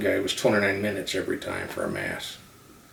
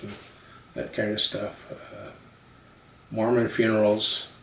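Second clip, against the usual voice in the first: ordinary delivery, nothing marked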